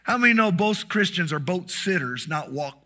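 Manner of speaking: ordinary talk, nothing marked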